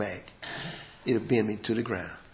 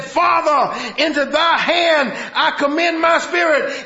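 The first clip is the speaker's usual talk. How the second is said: shouted high and slow, Father ringing out; full-voiced triumphant shout; loud cry; 13 semitones higher, 9 dB louder, slower than usual. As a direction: cry it out to the heavens. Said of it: "cried with a loud voice".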